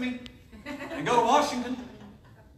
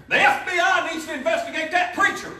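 mock accuser; 8 semitones higher, 6 dB louder than usual. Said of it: "she tweeted on there".

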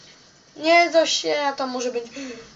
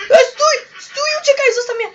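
high, loud yelling after it; frantic real shouts; shouting; 12 semitones higher, 8 dB louder than usual. Real, not acted. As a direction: yell at it to stop, much higher. Chasing something in the game.

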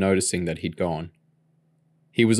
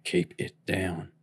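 whispered; 2 semitones lower, 8 dB quieter, slower than usual. A narrator's reading. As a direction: keep it down so the kids don't wake. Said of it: "Bill whispered".

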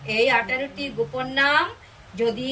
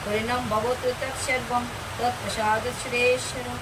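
closing prayer intonation; much slower than usual. Real, not acted. Closing Sanskrit prayer, om shanti.

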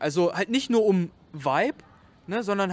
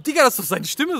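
surprised fan; 7 semitones higher, 7 dB louder, faster than usual. Retold or imitated. Fans recognise his voice.

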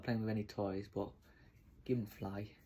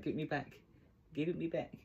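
dog's voice; 7 semitones higher than usual. Pretend.

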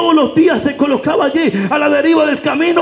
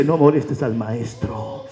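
a hint of someone telling him to hush; 2 semitones higher, 6 dB quieter than usual. Crowd hushes the blind man. (alguien lo manda callar, en voz baja)

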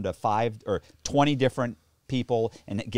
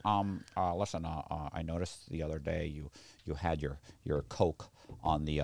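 lower, softer, hesitant mumbling scold; a nervous nobody scolding a big shot; mock meek crackhead; 7 semitones lower, 6 dB quieter than usual. Crackhead scolding bank owner, mock meek hesitant voice.

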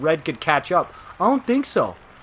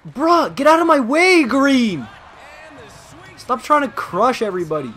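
much higher, louder yell at a rival; frustrated yell; 7 semitones higher, 4 dB louder than usual. Real, not acted. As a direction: shove him off the puck with your voice. Yelling at opponent in game.

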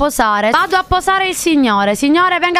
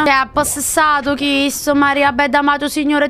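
ordinary talk

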